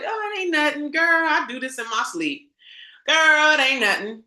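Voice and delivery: high and breezy, brushing it off; mock the one who gives it away; high, casual "it ain't nothing, girl"; breezy dismissive quote. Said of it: "mocking a gifted person shrugging".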